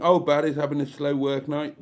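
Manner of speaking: usual speaking voice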